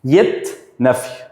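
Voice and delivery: ordinary talk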